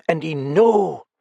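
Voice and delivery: put-on accented woman stressing know with dread; stressed word. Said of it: "She stressed the word".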